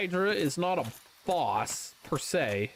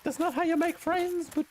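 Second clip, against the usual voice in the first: mock victim; 11 semitones higher than usual. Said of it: "And you're just like".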